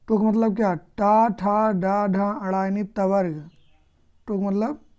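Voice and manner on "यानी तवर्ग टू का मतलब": usual speaking voice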